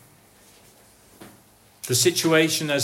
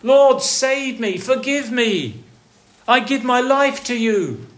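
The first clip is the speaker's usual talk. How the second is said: slow, raised pleading prayer; heartfelt cry; 6 semitones higher than usual. Acted. A sinner crying to the Lord, slow raised prayer. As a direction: pray it out, slow and higher. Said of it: "the Lord will hear that cry".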